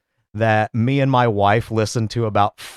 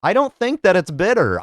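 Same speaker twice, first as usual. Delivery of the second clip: usual speaking voice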